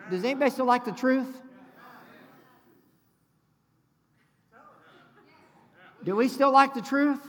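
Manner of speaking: loud, high challenge to the congregation; rallying call. Real, not acted. Preacher calling out to congregation.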